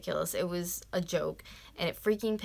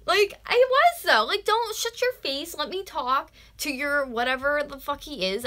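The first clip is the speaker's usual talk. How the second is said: much higher and louder, telling her off; heated retort; 8 semitones higher, 7 dB louder than usual. Retold or imitated. Reliving telling the wife off in traffic, heated and loud. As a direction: snap back furiously, cutting her off.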